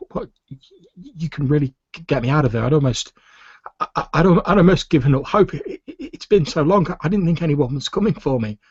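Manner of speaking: high, stammering prisoner daring to hope; halting disbelief at being rescued; flickering hope. Acted. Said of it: "faint sort of flickering of hope".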